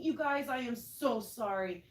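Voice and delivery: ordinary talk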